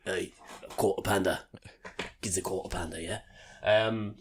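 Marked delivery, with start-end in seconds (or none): 0.1-3.0 Cockney accent. Shown as a demonstration. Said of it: "It's like Cockney".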